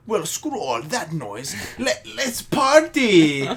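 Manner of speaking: slow mock announcement rising to a high cry; party-starting ancestor. Imitating the movie's ancestor kicking off a dance, hammed-up party call. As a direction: draw it out, then whoop up the party.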